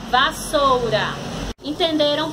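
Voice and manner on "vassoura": "Vassoura" as one whole word; palavra juntada. Shown as a demonstration. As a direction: join the syllables of "Vassoura". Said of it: "separação de palavras". ("Vassoura" dito como palavra inteira)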